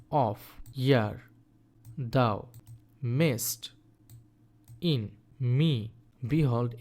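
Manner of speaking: each word said on its own with pauses between; syllable by syllable. Shown as a demonstration. Teacher to class, counting syllables with a pause after each word. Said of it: "প্রথমে তুমি যদি বলো that".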